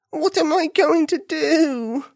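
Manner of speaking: high, slow and dismayed; high bunny voice. Acted. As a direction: wail it slowly to yourself. Dismayed bunny thinks aloud.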